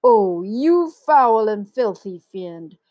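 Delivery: slow, loud indignation; outraged elephant cry. A narrator's reading. Elephant cursing the crocodile, outraged and slow. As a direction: denounce him, stretching the insults. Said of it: "cried Trunky".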